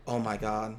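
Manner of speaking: quiet dismayed groan; stunned murmur. Real, not acted. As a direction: let it fall out of you in disbelief. Realizes his costly mistake.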